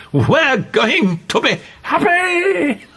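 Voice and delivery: high, joyful wild shout; laughing and shouting. A narrator's reading. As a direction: cry it out in crazed joy. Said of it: "laughing and shouting".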